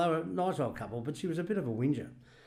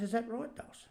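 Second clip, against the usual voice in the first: teasing; 11 semitones higher, 6 dB quieter than usual. Retold or imitated. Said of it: "I said".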